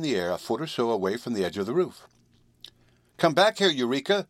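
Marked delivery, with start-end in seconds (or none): 3.2-4.3 high, loud call of alarm; distressed call. A narrator's reading. Girl calling her kitten back from the edge, distressed. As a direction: call out anxiously, pitched well up. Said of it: "she called in distress".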